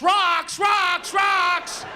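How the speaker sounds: high shouted rocks, rocks, rocks; mock alarm yell. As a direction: yell the warning like a panicked sonar. Yelling warning about rocks.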